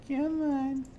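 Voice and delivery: high-pitched coaxing "come on". Real, not acted. Player waiting on the livery stable man, coaxing. Coaxing the game along, higher repeat.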